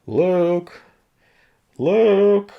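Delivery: high, loud call stretched out across the farm; Uncle Owen calling. Retold or imitated. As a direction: yell the name out like a gruff old farmer calling his nephew home. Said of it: "you hear Owen going".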